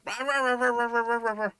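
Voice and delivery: high, nonstop mock barking; dog barking. Owner imitating his collie barking at the cops, high yapping. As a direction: bark it like a dog that won't quit. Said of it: "The only thing he could do was just bark".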